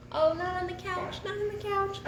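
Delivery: high, light pet-talk voice; high-pitched, unforceful fussing. Real, not acted. Fussing at the cat near couch.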